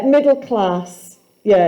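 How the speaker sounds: ordinary talk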